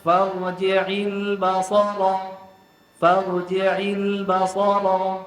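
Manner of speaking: slow chanted farji'il basara, stress on ba; wrong stress. Shown as a demonstration. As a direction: intone basara pressing on ba. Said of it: "itu tak betul".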